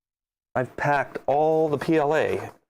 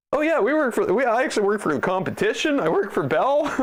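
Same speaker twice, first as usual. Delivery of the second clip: high, laughing impersonation; amused, hammed-up high voice; mocking agent; 7 semitones higher, faster than usual. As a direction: play the gleeful double agent, breaking into laughter. Jokes as agent secretly working for competition.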